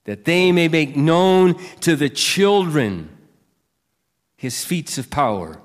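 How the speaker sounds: scripture reading. Acted. Pastor reading Psalm 145 aloud to the church, slow and proclaiming. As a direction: lift each line up as praise.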